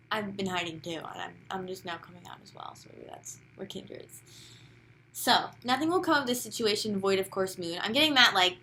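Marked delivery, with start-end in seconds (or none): 0.1-4.2 soft confession, voice fading away; quietly moved, sinking toward a whisper; emotional. Real, not acted. Reader confiding a kinship with the listener, quietly emotional. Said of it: "I'm getting emotional with you".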